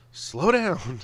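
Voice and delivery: a laughing call to hold up. Retold or imitated. Teasing a fast-mumbling coworker, calling out loud. Telling fast talker to slow.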